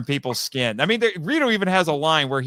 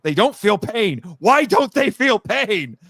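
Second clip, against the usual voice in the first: high, loud mock whine of a bored villain; whiny complaint; 7 semitones higher, 5 dB louder than usual. Creator quoting Rito complaining there's no pain. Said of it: "Rito complains".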